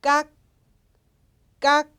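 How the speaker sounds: 깍 as one short syllable cut off by a final k; pronunciation demo. Shown as a demonstration. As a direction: teach 깍 crisply, stopping on the k. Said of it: "이렇게 읽어주시면 됩니다".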